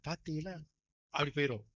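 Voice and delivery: usual speaking voice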